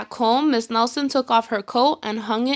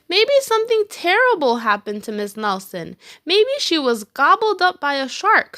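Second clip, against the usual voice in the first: put-on higher, louder worried child; kid's voice; 6 semitones higher, 3 dB louder than usual. A narrator's reading. Kid guessing teacher's fate, higher child voice. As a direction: speculate like a fretful kid. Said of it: "said one of the kids".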